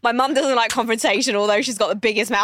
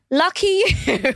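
higher, drawn-out quip; imitated mum; 7 semitones higher, slower than usual. Mum turning round to the stranger, high and pointed. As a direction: stretch it out pointedly, pitched up. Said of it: "and goes".

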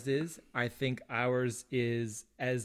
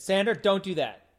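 raised, louder retold telling-off; parental scolding; 7 semitones higher, 9 dB louder than usual. Parent retelling a warning to young son, mock scolding. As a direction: re-enact a firm warning to a child.

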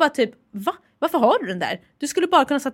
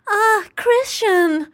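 mock audiobook moan; 10 semitones higher, 4 dB louder, much slower than usual. Parodying the erotic audiobook heroine for her co-host, high, slow moan. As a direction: swoon the name, much higher and slow. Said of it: "Exakt så pratar de".

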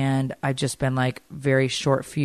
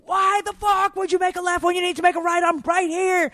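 high exasperated imitated shout; hammed-up furious phone yell; mock angry yell. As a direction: scold a sister for the wrong turn. Imitating daughter yelling on phone.

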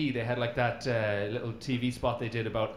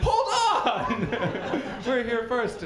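high laughing mock yell; hammed-up high yell through laughter; mock shout; 8 semitones higher, slower than usual. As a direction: cry out to be noticed, half laughing. Ireland demanding notice, laughing.

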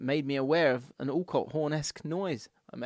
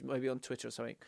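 ordinary talk

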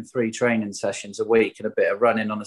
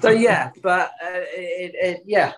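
words bubbling out of a laugh; 7 semitones higher than usual. Real, not acted. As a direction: still chuckling, pitch up high. Right after laughing at own joke.

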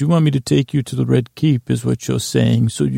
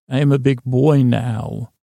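ordinary talk, nothing marked

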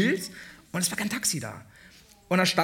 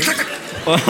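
punchy, louder zack zack zack with a laugh; rhythmic zack sounds; 6 dB louder than usual. Shown as a demonstration. Asked to repeat it, stabbing zack zack zack laughing. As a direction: snap out each zack like a sting. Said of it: "kannst du nochmal machen, bitte".

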